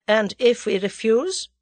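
plain reading voice

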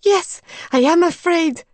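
high, loud, fearful; high frightened confession; frightened admission. A narrator's reading. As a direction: admit the fear with a tremor of panic. Medium admits fear.

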